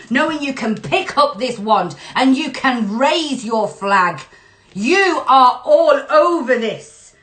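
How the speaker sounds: loud, high, emphatic rallying cry; rally them with full conviction; rousing exhortation. Real, not acted. Reader urging listeners to armor themselves, rousing exhortation.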